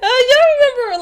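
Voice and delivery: giddy outburst after laughing; excited. Real, not acted. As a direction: blurt it out giddily.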